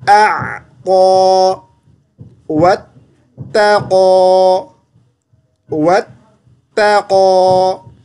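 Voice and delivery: slow drawn-out recitation; tilawat recitation. Shown as a demonstration. Teacher models the recitation for the student, slow. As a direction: chant each word slowly.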